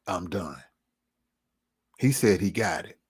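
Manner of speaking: ordinary talk, nothing marked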